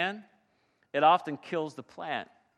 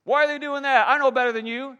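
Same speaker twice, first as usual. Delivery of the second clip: higher, louder whiny grumbler; 7 semitones higher, 6 dB louder than usual. Acted. Pastor mocking a complaining churchgoer, higher and louder. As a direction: play the know-it-all who always complains. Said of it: "It's this constant grumbling".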